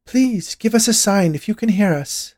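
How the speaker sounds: high, loud plea to spirits; undaunted medium. A narrator's reading. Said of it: "Esmeralda continued, undaunted".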